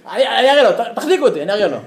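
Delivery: hammed-up hold-me-back bluster; mock blustering threat. Blustering man wants to fight.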